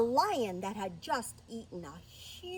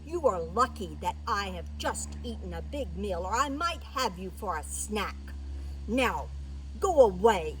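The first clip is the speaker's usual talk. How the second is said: loud, commanding lion voice; warn, then loudly order them off; imperious big-beast dismissal; stern lion; 2 semitones lower, 7 dB louder than usual. Acted.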